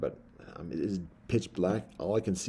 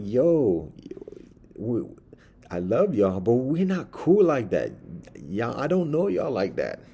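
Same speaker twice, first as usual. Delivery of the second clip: louder, slow, apologetic but firm; firm explanation; 4 semitones higher, 5 dB louder, slower than usual. Retold or imitated. Man explaining to the mice, loud and firm. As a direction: let them down loudly but kindly.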